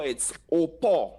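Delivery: slow, careful patois "au pot"; teaching "au pot" the patois way; patois place name. Shown as a demonstration. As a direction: say "au pot" slowly, open o then pot. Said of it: "So let's say that together".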